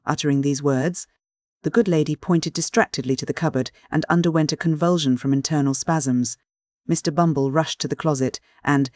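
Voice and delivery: usual speaking voice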